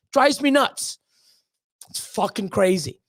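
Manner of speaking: normal talking voice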